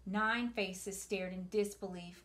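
ordinary delivery

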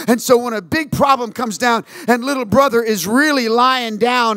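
rising preacher fervor; preaching. Acted. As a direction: preach it to the church. Preaching builds.

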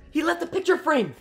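high-pitched alarmed exclamation. Real, not acted. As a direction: cry it out in disbelief. Repeats it in alarm.

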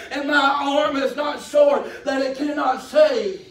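loud, slow, ringing sermon delivery; impassioned pulpit cry; full-force preaching. Real, not acted. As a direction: proclaim it to the congregation, drawing out the key words.

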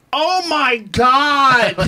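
high, drawn-out gasping exclamation; stretched high mock outcry; mock cry out. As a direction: imitate a stunned woman crying out slowly. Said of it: "I hear a woman in the row behind us cry out".

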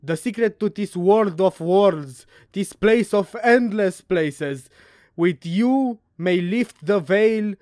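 loud, theatrical declamation; solemn proclamation, projected loudly; dramatic letter reading. Acted. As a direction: declaim the letter like a grand speech.

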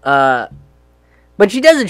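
ordinary talk

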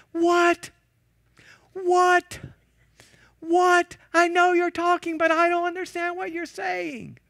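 high, loud, straining to be heard; shouting back. Retold or imitated. Minister shouting back over waves, loud confused yell. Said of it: "the student minister was".

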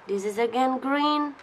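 ordinary delivery

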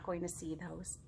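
a playful stage whisper. Acted. Teacher confiding to little listeners, playful whisper. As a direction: whisper it like a secret to the kids. Secret aside to the children.

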